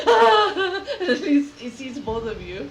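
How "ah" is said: high, loud cartoon scream; hammed-up shriek of terror; mock screaming. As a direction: yell like a panicked man running downstairs. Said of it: "And then he sees you guys".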